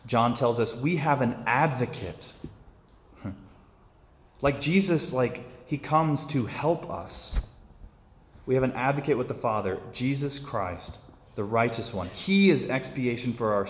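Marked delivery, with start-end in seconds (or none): none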